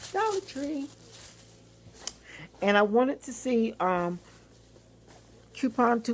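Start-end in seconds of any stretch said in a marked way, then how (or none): 0.1-0.9 Dollar Tree popped up high and quick; high repeat. Real, not acted. Vlogger repeating her next stop, higher.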